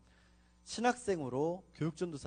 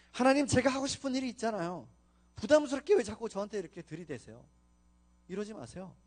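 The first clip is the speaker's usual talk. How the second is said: whiny protest; 6 semitones higher, 5 dB louder than usual. Retold or imitated. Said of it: "계속 그렇게 얘기했어요".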